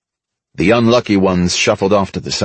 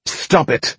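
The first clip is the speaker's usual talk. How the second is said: a held-back shout, pitched up; restrained shout; 7 semitones higher than usual. A narrator's reading. Mickey pulls Buck's gun arm down, restrained shout. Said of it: "he shouted at me".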